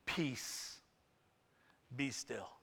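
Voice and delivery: soft, hushed, calm command. Retold or imitated. Jesus calming the storm, soft hushed command. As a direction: still the storm gently. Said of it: "what does he say?".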